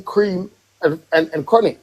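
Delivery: ordinary talk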